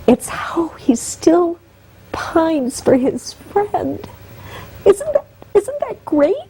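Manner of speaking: real tears rising, pitch climbing as her voice breaks; slow, breaking into a sob; choked up. Real, not acted. Guest on a talk show, crying over her hero's loyalty.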